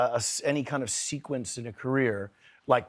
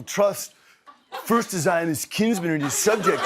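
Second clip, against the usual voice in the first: higher, louder hammed-up movie-star Shakespeare; Keanu Reeves; 6 semitones higher, 5 dB louder than usual. Actor showing the audience his rendition of Macbeth, hammed-up impression. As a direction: declaim Macbeth in a hammed-up surfer-style impression. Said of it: "this is Keanu doing Macbeth".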